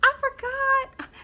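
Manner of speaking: high, sing-song Southern sweetness, laughing. Retold or imitated. Vlogger mimicking a country singer's sweet aside, high and laughing. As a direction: coo it like a sweet country girl. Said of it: "and she was like".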